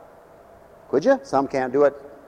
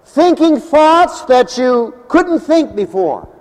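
preaching; 10 semitones higher, 6 dB louder, slower than usual. Acted. Preacher rising in pitch.

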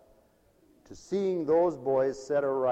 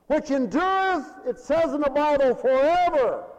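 raised preacher's cadence; preaching at full force; 12 semitones higher, 8 dB louder than usual. Acted. Preacher to congregation, ringing pulpit voice. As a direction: proclaim it from the pulpit.

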